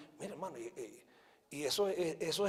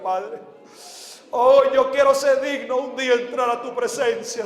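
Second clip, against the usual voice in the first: fervent, raised prayer, each phrase falling on "Señor"; oración de confesión; 3 semitones higher, 11 dB louder than usual. Real, not acted. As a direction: plead for mercy, leading the congregation. Dirige una oración de arrepentimiento. (oración ferviente de arrepentimiento)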